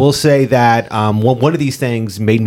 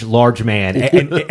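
ordinary talk, nothing marked

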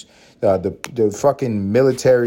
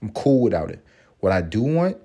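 ordinary talk

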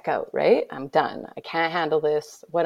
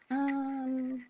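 put-on higher, softer, childlike voice; child voice; 8 semitones higher, 8 dB quieter, slower than usual. Her kid suggesting they dance, in a high childlike voice. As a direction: innocent small child. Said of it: "my kid looks at me and goes".

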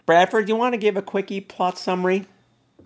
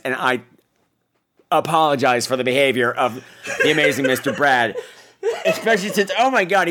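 ordinary delivery

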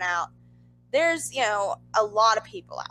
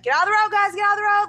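loud, raised call to clear the road; mock security shout; 8 semitones higher, 6 dB louder, much faster than usual. Security ordering the crowd out of the road, loud. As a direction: bark it like a guard waving people back. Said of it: "they're like".

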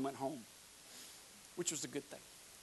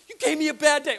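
loud, higher-pitched mock complaint; retold outburst; 8 semitones higher, 13 dB louder than usual. Retelling the angry visitor's complaint, loud mock outburst. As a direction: blurt it out loud and high.

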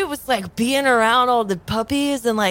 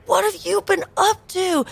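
eager catching up; 8 semitones higher than usual. Acted. Old friend greets classmate in character.

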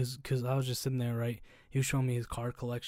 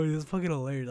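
usual speaking voice

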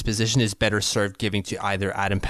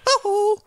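very high two-note hoot like a message alert; ICQ chime impression. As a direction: squeak out the ICQ ping. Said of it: "it would go".

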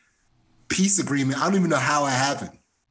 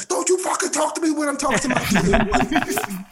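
much higher, louder, laughing through a hammed-up yell; mock furious mom; 12 semitones higher, 4 dB louder than usual. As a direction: play mom snapping back at a stranger, pitched way up. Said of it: "And my mom was like".

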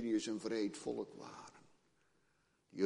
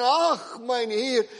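high, loud, panicked pleading; desperate servant; 14 semitones higher, 13 dB louder than usual. Acted. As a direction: plead in terror, seeing no way out. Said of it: "Je hoort de nood uit zijn woorden".

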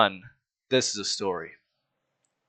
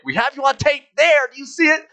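high, loud put-on accuser; hammed-up interrogation voice; Incredibles interrogation; 6 dB louder than usual. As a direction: squeal it like a frantic movie accuser. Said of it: "in The Incredibles where it's like".

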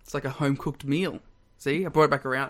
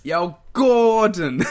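loud, drawn-out Italian American call, raised pitch; Italian American accent; 5 semitones higher, 8 dB louder, much slower than usual. Acted. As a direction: copy a hammy Italian American shout. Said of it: "an Italian American accent".

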